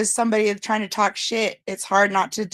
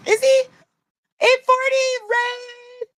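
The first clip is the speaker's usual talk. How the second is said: much higher, loud hype; excited; 14 semitones higher, 4 dB louder, slower than usual. Real, not acted. Greeting viewers on her live, excited high hype. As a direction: fire up the chat. Hyped reading of live chat.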